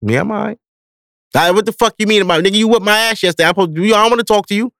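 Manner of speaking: high-pitched, hurried aggrieved comeback; mock indignant reply. Son answering dad's next-day call, mock indignant. Acts his sulky phone reply.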